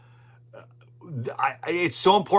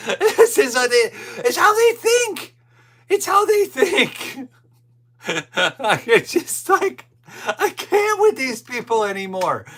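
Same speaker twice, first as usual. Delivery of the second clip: high, breathless laughing voice; 12 semitones higher, 5 dB louder than usual. Real, not acted. Host cracking up after mocking the staffers, laughing. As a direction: laugh through the words.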